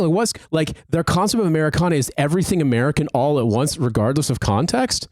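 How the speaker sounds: ordinary delivery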